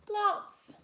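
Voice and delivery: high, loud breezy mum voice; high loud careless answer; spider mum. Acted. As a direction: toss it off like a busy mother spider. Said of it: "said Mum".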